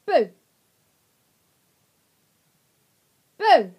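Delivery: sharp shout to startle; jump-scare the puppy; sudden loud boo. Real, not acted.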